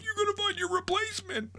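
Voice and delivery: mock her with a shrill cartoon squeak; squeaky mock boss. The boss calling after him as he leaves, in a high mocking squeak. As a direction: voice jumps way up into a silly squeak.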